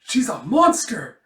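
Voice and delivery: high, loud acted alarm; hammed-up dismay; horrified cry. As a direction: exclaim in horror at a tyrant. Student aghast at Schlag.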